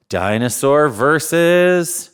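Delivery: slow, drawn-out announcer call; slow showman announcing the next match; announcer build-up. Acted. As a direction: stretch versus like a ring announcer.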